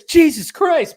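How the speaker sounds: high, loud retold exclamation; exclaimed movie quote. Host quoting the license plate scene, high loud exclamation. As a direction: cry it out as a movie character would. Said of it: "and he says".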